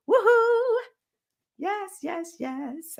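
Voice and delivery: high whoop of joy; cheer. Real, not acted. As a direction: whoop for the viewers. Cheering in live chat.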